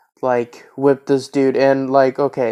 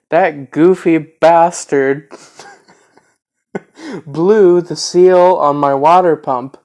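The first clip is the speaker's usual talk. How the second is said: laughing; 4 semitones higher, 6 dB louder, slower than usual. Real, not acted. Laughing at the damage.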